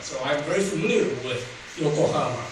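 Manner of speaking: ordinary talk, nothing marked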